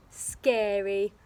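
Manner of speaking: plain reading voice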